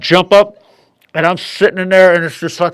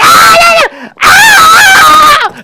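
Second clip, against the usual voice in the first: very high, loud screaming; pained scream; 9 dB louder, much slower than usual. Retold or imitated. Man stung by bees, shrieking in pain. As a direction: shriek as the stings hit.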